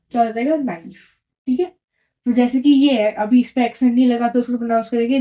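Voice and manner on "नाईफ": "नाइफ" as naa-eef; vowels sounded separately. Shown as a demonstration. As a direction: say aa, then ee in "नाइफ". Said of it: "ए को pronounce करते आ". ("नाइफ" में आ और ई अलग)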